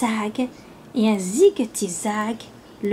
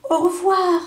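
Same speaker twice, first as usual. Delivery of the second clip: called farewell; 7 semitones higher than usual. A narrator's reading. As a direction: call it out as you leave. Farewell called while flying away.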